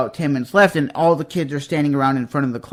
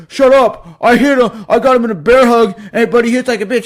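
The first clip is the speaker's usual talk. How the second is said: defensive mock bully; 5 semitones higher, 4 dB louder than usual. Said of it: "he was like".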